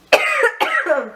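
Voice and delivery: high coughing fit; choking cough. Real, not acted. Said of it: "donc désolée je m'étouffe".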